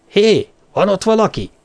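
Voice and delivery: challenging "hey" shouted out; calling out. Acted. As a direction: call out to whoever is hiding. Calling out toward the bushes.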